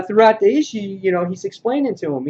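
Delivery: normal talking voice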